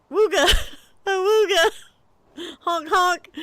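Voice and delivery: high, loud wooga and honk through laughter; goofy car-horn wooga-honk; silly horn noises. Retold or imitated. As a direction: honk like a clown horn to break the tension. Silly noises to cover awkwardness.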